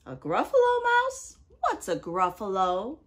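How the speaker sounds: the owl hoots in disbelief; surprised owl. Acted. Owl asks.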